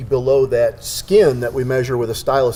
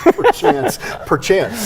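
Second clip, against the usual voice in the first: laughing echo; 8 semitones higher, much slower than usual. Real, not acted. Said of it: "echoes the posh word laughing".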